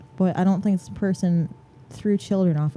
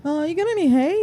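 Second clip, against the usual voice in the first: high-pitched horse asking a favour; hammed-up horse voice, pitched up; casual horse; 10 semitones higher than usual. Horse begging the sleeper for food, casually.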